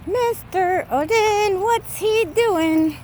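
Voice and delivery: high, slow sing-song voice to the dog; pet talk. Real, not acted. Owner cooing at his dog Odin, high and slow.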